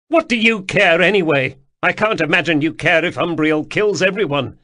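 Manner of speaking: high, loud accusing cry; upset yelling. Acted. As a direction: throw it at him in tears. Atropos continues upset outburst.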